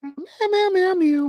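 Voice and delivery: high-pitched imitation cat meows; playful meowing. Filler while checking the time, playful high meows. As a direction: meow idly in a tiny high voice.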